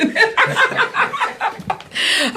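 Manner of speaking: high-pitched burst of laughter; real, delighted high laugh. Real, not acted. Laughing after thanking the guest.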